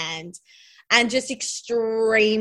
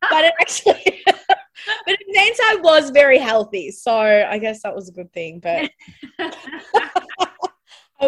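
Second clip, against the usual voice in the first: laughing; 4 semitones higher, 6 dB louder, slower than usual. Real, not acted. Laughing at own diet story.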